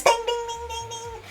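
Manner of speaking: high light bell sound; ping each ding like a bell; high ringing dings. Retold or imitated.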